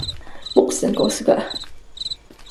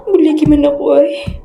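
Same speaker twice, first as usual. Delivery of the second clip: panicked plea; 9 dB louder than usual. Acted. Pleading prayer in panic.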